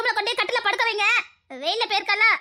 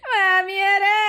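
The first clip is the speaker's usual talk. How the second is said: loud mock wail for the mother-in-law; hammed-up sobbing cry; fake crying shout; 2 semitones higher, 4 dB louder, much slower than usual. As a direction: howl her name like a staged mourner. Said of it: "performance பண்ணு".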